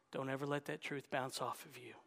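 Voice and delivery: normal talking voice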